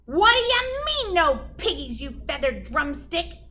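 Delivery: high, loud furious screech; screeching witch. Acted. Gritch screeching at the chicken, high and loud. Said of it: "Grit screeched".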